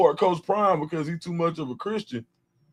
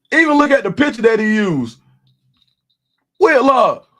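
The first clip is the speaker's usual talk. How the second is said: loud, high, incredulous roast; excited mockery; 5 semitones higher, 8 dB louder than usual. Real, not acted. Streamer mocking Whitlock's photo, loud incredulous roast. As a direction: point at it loudly, mocking.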